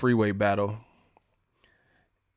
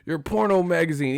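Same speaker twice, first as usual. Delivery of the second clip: nagging drivers; 8 semitones higher than usual. Retold or imitated. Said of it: "They're like".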